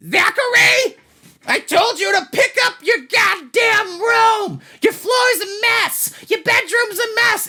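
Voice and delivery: high, slow, shouted scolding mother; mom yelling. Retold or imitated. Mother yelling at her teenage son about his messy room, high shouting. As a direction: bellow it down the stairs like an angry mom. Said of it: "she just yelled and she goes".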